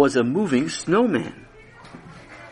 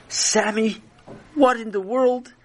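astonished; 8 semitones higher than usual. Acted. Father alarmed.

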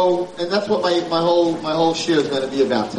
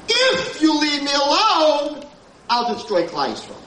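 raised and slow, almost shouted; slow booming divine voice; 10 semitones higher, slower than usual. Acted. Rabbi voicing what Moshe heard from Hashem, slow shout. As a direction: let each word land like a decree.

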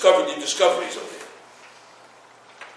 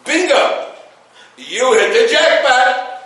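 higher, louder, slow and beaming; joyful divine announcement; 5 semitones higher, 4 dB louder, slower than usual. Acted. Hashem tells Israel they won the lottery, elated and drawn out. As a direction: announce the jackpot like a game-show host. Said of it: "know what Hashem says?".

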